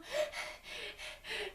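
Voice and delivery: soft, high little titter; small imitation of leftover giggles; quiet mock tittering; imitated dying-down giggle. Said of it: "It was just more of a little".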